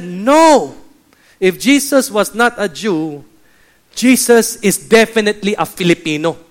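loud, emphatic mock protest; Pastor Tony. Filipino pastor outdoing the other pastors, loud emphatic retelling. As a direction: object loudly, sure of winning the argument. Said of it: "Pastor Tony said".

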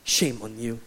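low, softened scolding; mock reproach. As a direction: disapprove gravely like a judgmental believer. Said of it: "They will say to you".

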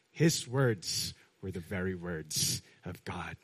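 ordinary talk

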